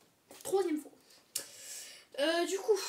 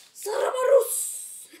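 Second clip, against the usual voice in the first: high, drawn-out showman's cry; hammed-up, very loud intro flourish; mock-Italian shout; 11 semitones higher, 14 dB louder, much slower than usual. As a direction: belt out a fake Italian catchword.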